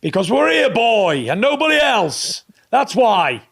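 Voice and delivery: loud, high, commanding general's answer; ringing voice of an army officer; old general. Acted. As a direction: bark it out like an old general to a young soldier. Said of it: "And the old general said".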